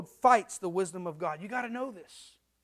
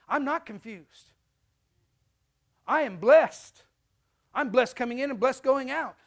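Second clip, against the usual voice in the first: preaching; 7 dB louder than usual. Acted. Preaching at full force.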